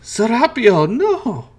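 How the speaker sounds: high, loud cry to her son; mother crying out her son's name; desperate calling out. Acted. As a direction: call out across the room in panic. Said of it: "who's calling out".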